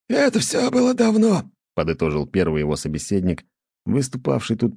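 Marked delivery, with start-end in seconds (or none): 0.1-1.5 high, creaky village elder; old elder voice. A narrator's reading. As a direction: voice the old man gently. Elder sums up the story. (голос старика)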